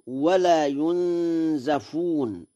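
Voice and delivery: slow "ولا ينزفون", said yunzafun with a; fatha on zay. Shown as a demonstration. Lecturer shows Warsh's reading to listeners, "ينزفون" as yunzafun. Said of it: "بفتح الزاي".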